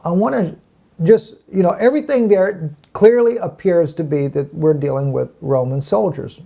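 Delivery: usual speaking voice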